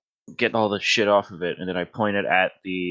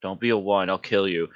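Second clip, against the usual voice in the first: ordinary talk, nothing marked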